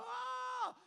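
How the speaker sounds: high, drawn-out yelled "oh"; frustrated yell. Retold or imitated. Preacher reenacting how he yelled while worried, yelling. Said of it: "I'm yelling, I'm like".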